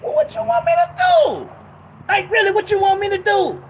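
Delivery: real exasperation at being told what not to say; exasperated outburst. Real, not acted. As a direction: throw up your hands and shout the question. Exasperated outburst after mock quote.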